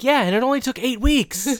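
high, loud mock insistence; enthusiastic boast. [name] defending his mom's tape, enthusiastic boast. Acted boast answering the objection.

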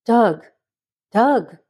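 mild, urgent call to rouse someone; calling his name. A narrator's reading. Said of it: "heard Maynard's voice calling his name".

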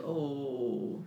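soft, pitying sigh of a word; hushed, mildly sorrowful imitation; mock sad. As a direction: imitate someone softly saddened for you. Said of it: "they're always sad".

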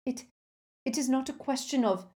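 haltingly defensive; stammering. A narrator's reading. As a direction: stumble on the first word, losing composure. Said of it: "she stammered".

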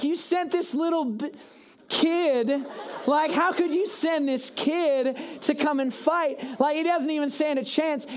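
high, slow, scornful jeering; mock the kid in disbelief; taunting giant. Goliath taunting David as he looks at him.

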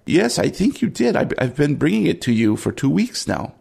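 high-pitched cook gently reminding a friend; old Cajo, teasing. Acted. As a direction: start high, in a friendly character voice. Game master plays Cajo.

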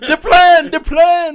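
high, loud excited little cry; hammed-up TV character impression, very high; Tattoo. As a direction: call it out in a high-pitched mock accent. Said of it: "that sounds like Tattoo from Fantasy Island".

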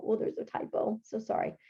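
quick whispered apology to herself; whispered aside. Real, not acted. Teacher spotting a typo, whispered aside. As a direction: drop to a whisper to note the typo. Noticing typo mid-read.

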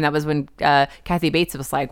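ordinary delivery

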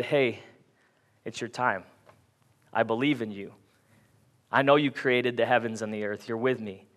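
low, soft, unhurried assurance; calm trust. Pretend. Preacher imagines disciples trusting God in the storm, calm trust.

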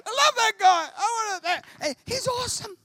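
very high, loud mock-weepy gush; mock crying fan. Preacher acting himself crying in the cinema, high mock-weepy gush. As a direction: overdo the teary fan. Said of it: "I'm sitting in the cinema crying".

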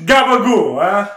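hammed-up mobster Italian; Italian accent. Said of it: "they both sound like the fucking legit".

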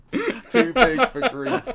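slow, high and loud through laughter; words breaking into a big laugh. Real, not acted. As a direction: crack up while saying it. Cracking up at own joke.

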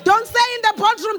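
ordinary delivery

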